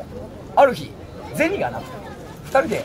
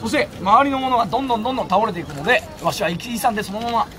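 ordinary talk

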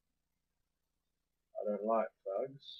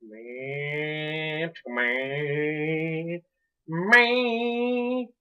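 long drawn vowels, last one much higher; drawn-out wail; 4 semitones higher, 6 dB louder, much slower than usual. Real, not acted. Player alone at the game repeats himself, rising drawn-out wail. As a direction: stretch each word, climbing to a loud high whine.

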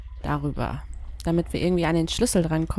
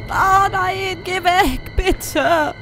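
terrified pleading; 8 semitones higher, slower than usual. Real, not acted. Scared gamer begging the teddy to help, desperate plea. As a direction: plead for help with real fear, much higher.

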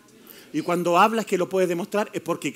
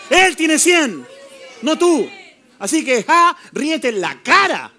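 rising, louder declarations with Él hit each time; fiery preaching; 4 semitones higher, 4 dB louder than usual. Acted. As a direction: build the chant against the devil. (predicación enérgica y creciente)

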